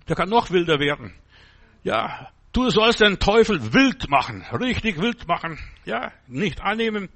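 ordinary delivery

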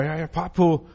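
ordinary talk, nothing marked

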